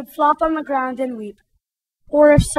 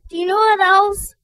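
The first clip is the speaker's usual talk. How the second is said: starting to wail; 7 semitones higher than usual. Acted. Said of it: "she cried".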